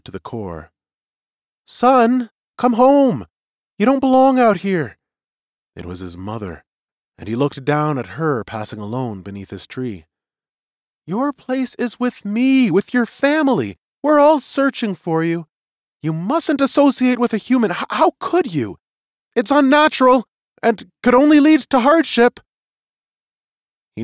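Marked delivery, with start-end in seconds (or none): 1.7-5.0 high, loud pleading mother; high-pitched, urgent maternal cry; mother calling out. A narrator's reading. Mother calling for her lost son. 11.1-22.4 high-pitched, distressed mother voice; high, urgent motherly appeal, growing louder; pleading mother. A narrator's reading. Mother pleads with hidden son.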